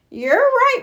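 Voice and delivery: Grandma's voice. A narrator's reading. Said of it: "said Grandma".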